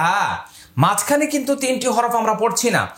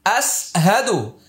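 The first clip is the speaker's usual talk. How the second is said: slow recitation; 2 semitones higher, slower than usual. Shown as a demonstration. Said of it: "আবার আয়না ধরুন".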